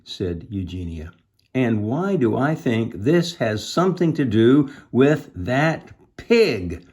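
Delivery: put-on slow, animated children's story narration; read-aloud storytelling. A narrator's reading. Reader telling children of Eugenia's search, animated storytelling. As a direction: draw out key words with lively pitch.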